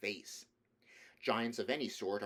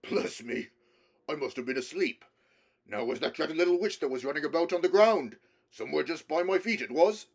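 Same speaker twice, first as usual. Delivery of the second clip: louder, booming giant just waking; drowsy giant; 6 semitones higher, 10 dB louder than usual. A narrator's reading. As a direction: rumble it out like a sleepy giant. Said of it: "and said".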